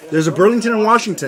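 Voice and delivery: normal talking voice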